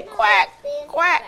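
soft imitated quacks fading away; duck quacking. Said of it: "the duck way down at the pond say".

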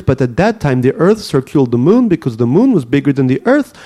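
put-on "circled" and "Earth" in a thick Russian accent, rolled r, hard consonants; a kid reading his novel in heavy Russian accent. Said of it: "the Russian accent is still thick".

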